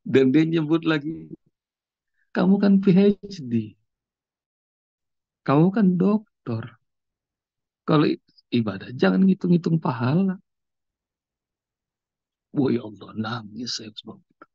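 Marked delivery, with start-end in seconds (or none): 2.3-10.4 mysterious stranger gently reminding Adi to be sincere, raised voice; stranger's advice. Retold or imitated. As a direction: voice the mysterious man kindly scolding, pitched up. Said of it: "Terus dia ngomong gini".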